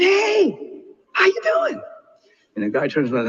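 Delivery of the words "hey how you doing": put-on friendly old man. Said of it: "He said".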